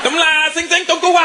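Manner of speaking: high, sing-song made-up Chinese; mock Chinese gibberish. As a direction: babble fake Chinese on the phone. Mock Chinese phone call gibberish.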